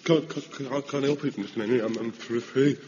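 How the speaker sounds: stammering, quiet and shivering; teeth chattering. Acted. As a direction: stutter through chattering teeth. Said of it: "It's Pike's teeth chattering".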